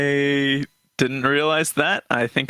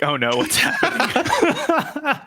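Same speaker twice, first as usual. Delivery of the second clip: much higher and slower, laughing through mock alarm; mock panic; 13 semitones higher, slower than usual. Player hearing that Rex shoots, hammed-up laughing panic. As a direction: squeal it like a hammed-up fright.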